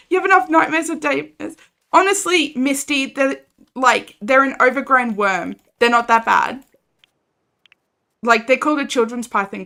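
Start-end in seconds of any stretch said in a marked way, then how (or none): none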